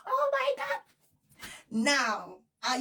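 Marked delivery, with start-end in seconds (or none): 0.0-0.8 very high, squealing oh my God; overjoyed squeal. Real, not acted. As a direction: squeal with joy. Overjoyed at the news.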